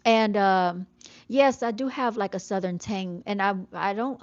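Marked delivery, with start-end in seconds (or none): none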